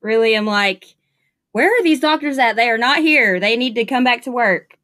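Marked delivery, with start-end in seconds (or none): 1.5-4.8 mock complaint. Mock complaint about absent doctors.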